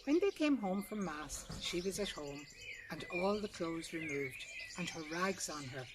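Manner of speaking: ordinary delivery, nothing marked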